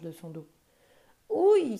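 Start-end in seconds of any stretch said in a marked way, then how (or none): 1.3-1.8 a sudden, much higher, much louder yelp; crying out. Acted. The monkey, thrown to the ground, cries out loud. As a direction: yelp as you hit the ground. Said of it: "s'écria ce dernier".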